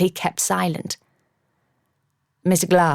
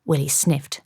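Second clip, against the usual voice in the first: plain reading voice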